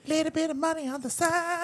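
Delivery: very high, goofy echo; silly high voice. Retold or imitated. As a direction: mimic in a squeaky voice.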